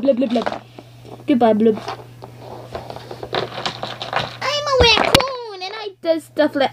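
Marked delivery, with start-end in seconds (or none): 3.3-6.7 very high, slow raccoon voice. Pretend. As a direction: drag it out high. Raccoon toy.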